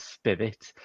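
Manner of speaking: ordinary delivery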